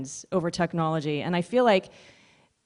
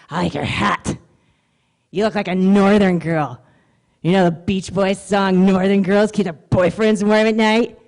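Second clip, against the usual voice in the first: sleazy flirt; 5 dB louder than usual. Retold or imitated.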